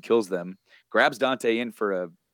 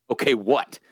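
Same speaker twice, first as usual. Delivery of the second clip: louder, punchy retold retort; a touch of impatience; mock challenge; 3 semitones higher, 4 dB louder than usual. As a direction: lightly act out a demanding comeback. Retold challenge, snapped back.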